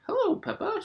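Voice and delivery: very high, light fairy voice; a tiny fairy greeting; high, delicate fairy voice; tooth fairy's voice. Acted. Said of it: "she whispered".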